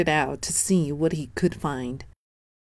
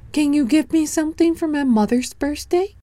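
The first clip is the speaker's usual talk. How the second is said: put-on bright, much higher little boy voice; high child voice; 10 semitones higher, faster than usual. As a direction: speak up high like an eager small boy. Danny asks the goat.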